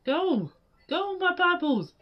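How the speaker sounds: ordinary talk, nothing marked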